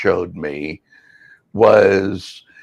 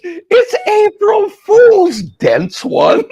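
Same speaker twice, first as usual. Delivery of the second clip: laughing; 6 dB louder, much slower than usual. Retold or imitated. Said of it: "she is just cracking up".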